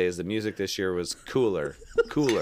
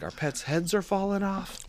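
movie quote voice; 11 semitones higher than usual. Retold or imitated. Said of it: "in Dumb and Dumber, he's like".

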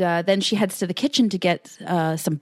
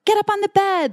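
high-pitched, louder, overly nice coaxing; 14 semitones higher, 5 dB louder than usual. Retold or imitated. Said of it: "she's this overly nice".

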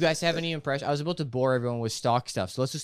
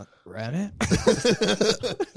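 drawn-out, higher-pitched incredulous comic voice; Sebastian; 7 semitones higher, 3 dB louder, much slower than usual. Acted. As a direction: stretch it out slowly, rising in disbelief. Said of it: "rest of this podcast as Sebastian".

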